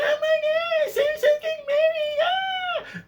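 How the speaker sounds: put-on high shrill girly squeal; squealing drunk girl. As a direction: squeal like an excited party girl. Mimicking drunk bachelorette squeal.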